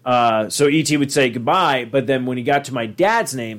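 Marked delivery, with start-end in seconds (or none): none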